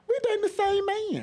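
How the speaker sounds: high, loud, put-on girl voice; high mock cashier reveal; voicing the girl.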